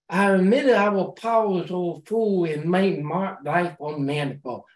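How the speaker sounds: ordinary talk, nothing marked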